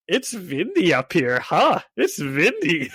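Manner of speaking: mock Russian accent, w turned to v; playful Russian-accented impression. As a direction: say it like a delighted Russian, "vindy". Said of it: "he's doing it all in, like, a Russian accent".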